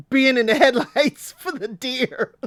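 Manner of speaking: laughing through the words; real laughter breaking in. Real, not acted. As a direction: crack up mid-line. Laughing at Billy.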